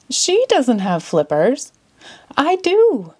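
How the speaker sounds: slow, then a high squeak; a lightly voiced seal; seal's voice. A narrator's reading. Seal in the audience claiming the flippers.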